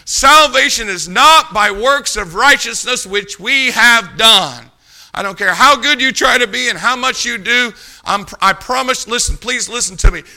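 punchy pulpit proclamation; forceful preaching. Real, not acted. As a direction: drive each phrase home to the congregation.

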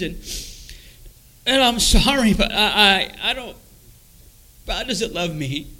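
high, pained, halting voice; despairing confession. Retold or imitated. Man telling Mom nobody loves him, despairing and high. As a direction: plead it wretchedly, voice lifted high.

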